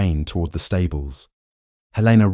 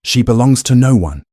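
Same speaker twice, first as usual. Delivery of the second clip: loud, high shout; 6 semitones higher, 7 dB louder than usual. A narrator's reading. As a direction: cut him off with a roar. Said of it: "Gideon shouted".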